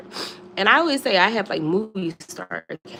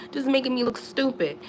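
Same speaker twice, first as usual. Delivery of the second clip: ordinary delivery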